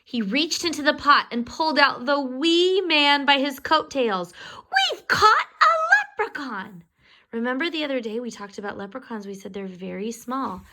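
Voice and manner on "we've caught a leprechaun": high, loud cry of triumph; high excited announcement; triumphant. A narrator's reading. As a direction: shout it with glee. Triumphant catch.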